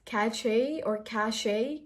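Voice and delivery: Cache as "cashay", slowly; mispronunciation options. Shown as a demonstration. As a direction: offer cachet as "ka-shay", questioning. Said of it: "How do you pronounce it?".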